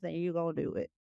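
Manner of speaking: usual speaking voice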